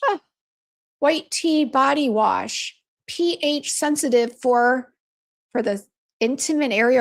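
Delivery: dragging out each word like a husband reading a label aloud; lightly voiced husband, slow and deliberate; slow mock label reading. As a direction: read it out slowly, mimicking him. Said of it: "He's like".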